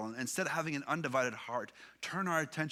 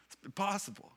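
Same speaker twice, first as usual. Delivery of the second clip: laughing; 7 semitones higher than usual. Real, not acted. Laughs at own admission.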